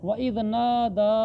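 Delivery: नादा recited slowly with a long-held "daa"; elongated vowel. Shown as a demonstration. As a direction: stretch the final aa of नादा. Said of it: "तो इसको खींचना है".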